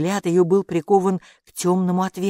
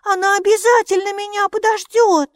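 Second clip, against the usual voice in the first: high, loud little voice; high-pitched defiant small creature; insistent protest; 7 dB louder than usual. A narrator's reading. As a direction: insist stubbornly, pitched right up. Maika keeps protesting.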